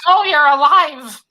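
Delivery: a crowd gasping that he lived; surprised relief. Retold or imitated. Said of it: "everyone's like".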